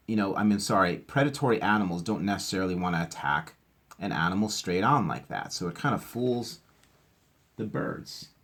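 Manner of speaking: usual speaking voice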